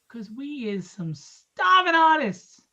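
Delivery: mock pleading, slow and loud; hammed-up plea. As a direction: drag it out like a begging starving artist. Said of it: "joking preacher-like plea".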